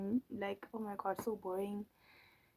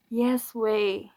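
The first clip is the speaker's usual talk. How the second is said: loud emphatic yes way; 9 dB louder than usual. Real, not acted.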